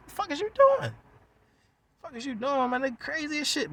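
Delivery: high-pitched, incredulous squawk of protest; mock protest. Acted protest during retold fight.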